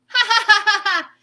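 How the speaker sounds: loud, high cartoon laugh; hammed-up ha ha ha; big hearty laugh. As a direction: belly laugh as the egg. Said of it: "Egg laughed".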